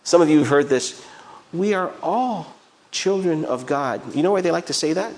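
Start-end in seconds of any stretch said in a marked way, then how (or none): 1.5-4.1 slow, higher, drawn-out feel-good slogan; singsong mock piety; mock sweetness. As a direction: imitate someone reciting a lovely platitude. Mimics a feel-good popular saying.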